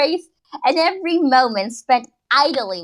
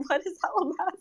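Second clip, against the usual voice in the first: laughing; 9 semitones higher, 9 dB quieter, much faster than usual. Real, not acted.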